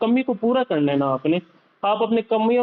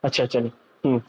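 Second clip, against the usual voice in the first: low, unhurried doctor asking a patient questions; doctor imitated with a lowered voice; calm doctor; 6 semitones lower than usual. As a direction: settle into a patient physician's lowered tone. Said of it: "ایسے بات کرتے ہیں نا".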